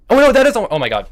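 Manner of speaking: loud, high-pitched oh my God at a blunder; gasping realisation of a blunder, loud and high; shocked outburst. Real, not acted. Streamer realising a chess blunder, shocked loud cry.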